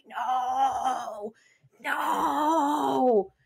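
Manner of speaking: a touch of a crying no; mock anguished no. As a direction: moan the nos in despair. Watching the favourite lose.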